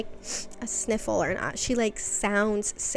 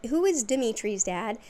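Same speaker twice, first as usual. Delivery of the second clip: alarmed; 2 semitones higher, 3 dB louder than usual. Retold or imitated. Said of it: "and is like".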